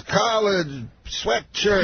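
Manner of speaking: high voice, each word on its own; Bill Cosby. Retold or imitated. Opener mimics the star explaining his outfit, Bill Cosby impression. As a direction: imitate a star comic talking just in words. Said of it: "he talks, like, just in words".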